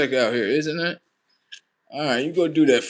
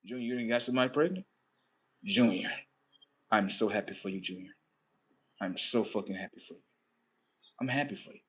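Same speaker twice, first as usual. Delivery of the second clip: soft, hushed, moved praise; tender joy; 5 semitones lower, 10 dB quieter than usual. Retold or imitated. Father to the son who got a girl pregnant, tender and soft. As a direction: say it softly, almost choked up.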